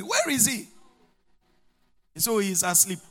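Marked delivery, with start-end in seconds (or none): 0.0-0.7 higher-pitched, exasperated call; angry demand. Retold or imitated. Preacher retelling his search for the sleeper, angry demand. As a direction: call out, irritated. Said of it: "I'm getting angry".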